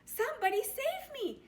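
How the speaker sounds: very high, loud panicked shout; stranded frog yelling for help. Acted. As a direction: scream for rescue over the storm. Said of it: "he shouted".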